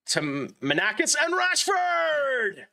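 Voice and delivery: high, drawn-out goal call; excited goal shout. Real, not acted. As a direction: belt it like a goal commentator. Goal celebration calling scorers.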